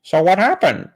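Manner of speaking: high, overeager mock curiosity; Kent Hovind. Kent prompting the terrorist's story, hammed-up high curiosity. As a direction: swoop up high on the question. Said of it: "this story is Kent Hovind".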